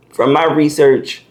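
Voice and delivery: talking through hiccups; hiccuping between words. Real, not acted. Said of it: "to get the hiccups".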